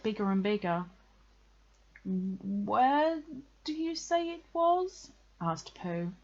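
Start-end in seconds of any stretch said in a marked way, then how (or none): 2.0-5.1 hesitant, rising pitch; stall, then ask high and worried; uneasy question. A narrator's reading. Pooh grows uneasy about the house.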